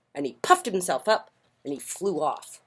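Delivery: plain reading voice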